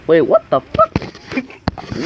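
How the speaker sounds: stunned disbelief. Real, not acted. Dead plants in the package.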